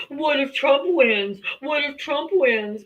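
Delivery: slow, taunting Trump supporter; drawn-out mocking whine. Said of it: "Trump supporters ask me, like".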